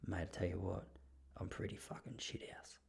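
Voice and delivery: imitating a gruff old bloke whispering; whispered confession. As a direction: lean in and breathe it like a secret. Grumpy resident confides quietly.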